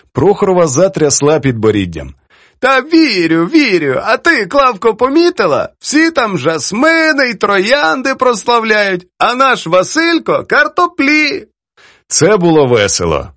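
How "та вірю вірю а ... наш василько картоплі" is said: put-on high-pitched, playful reproach from an elderly poetess; teasing old poetess. Poetess chides her young editor over his edits, teasing.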